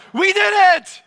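very high, very loud victory cry; jubilant shout. Shown as a demonstration. Preacher leads congregation in the phrase, shouting jubilantly. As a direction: yell it in triumph for all to copy. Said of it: "say it exactly the way I say it".

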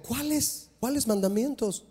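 soft, high, confused asking; a lightly voiced clueless youth; puzzled young man. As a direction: play the young man who has no idea. Young man's puzzled question, voiced.

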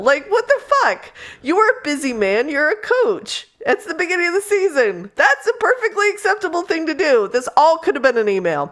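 high, fast, incredulous rant; exasperated rant. Real, not acted. Reader ranting in disbelief about the coach. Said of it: "ranting at the coach".

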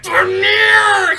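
shouted high through clenched teeth; through gritted teeth. Retold or imitated. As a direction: force the name out in rage. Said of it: "says Nicolas Cage through gritted teeth".

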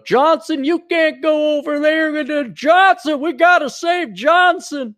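high, drawn-out cry across the battlefield; hammed-up desperate yell for a comrade; mock battlefield shout. Acted battlefield cry to save comrade.

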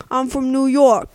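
mock New York accent; lightly imitated New York accent. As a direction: put on a New Yorker's talk. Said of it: "That was a terrible New York accent".

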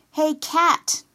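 high, loud call; cried out. A narrator's reading. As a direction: call out to get her attention. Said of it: "Bea cried".

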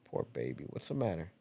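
gentle cooing to a pet; soft, tender pet talk. Real, not acted. Talking softly to the cat.

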